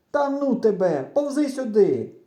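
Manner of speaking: high, loud impatient order; shouting back. A narrator's reading. Boy calling his friend over, impatient shouting. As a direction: yell it, fed up with arguing. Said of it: "Отак, перегукуючись".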